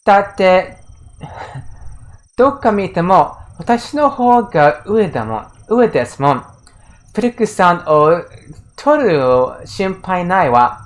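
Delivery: put-on a light smug taunting girl's voice; smug rival girl. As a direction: purr it like a haughty rival who knows she wins. Reading the rival girl's smug line.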